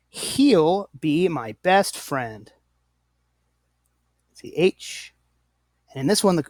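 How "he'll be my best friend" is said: He'll be my best friend said very slowly; slow example sentence. Shown as a demonstration. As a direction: read the sample sentence slowly for learners. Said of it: "It's faster to say".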